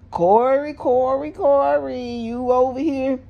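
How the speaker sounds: high, sing-song repeated name; high chiding sing-song calling out Corey. Acted. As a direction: tease him with each repeat.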